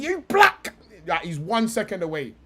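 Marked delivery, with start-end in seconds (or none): 0.0-0.7 high-pitched mock outburst; imitated outburst. Fan imagines the pundit about to snap, mock outburst. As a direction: blurt it in imitation anger, pitched way up. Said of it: "one second away from saying".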